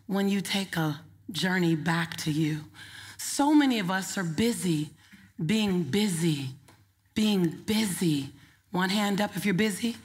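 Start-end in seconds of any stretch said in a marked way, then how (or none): 5.4-8.3 slow repetition. Shown as a demonstration. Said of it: "repeated for emphasis".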